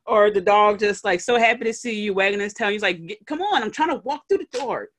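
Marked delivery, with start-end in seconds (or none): 3.1-4.9 annoyed owner. Retold or imitated. Owner pushing past excited dog.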